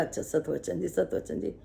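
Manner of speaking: soft, humble yes-saying; meek soft agreement. Retold or imitated. Devotee softly agreeing to the sister. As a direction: quietly give in, just agreeing.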